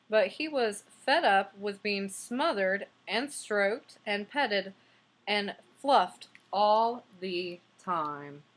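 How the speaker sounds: fed-up storybook read; slow emphatic listing. Shown as a demonstration. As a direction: stretch each word of the list. Reading aloud, fed-up listing.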